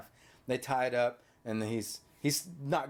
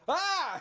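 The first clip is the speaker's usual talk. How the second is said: high, startled yelp mocking the kid; imitated scream. Mom mimicking her son's fright at the movie, high yelp. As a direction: shriek up high like you just got spooked. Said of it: "and you were like".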